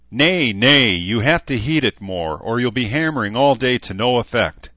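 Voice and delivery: loud gruff smith's growl. A narrator's reading. Smith Edmund growling at a clumsy apprentice. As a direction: correct the boy with a growl. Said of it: "Edmund growled".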